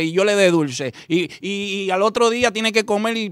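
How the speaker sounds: ordinary talk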